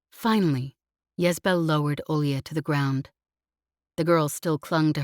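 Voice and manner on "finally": usual speaking voice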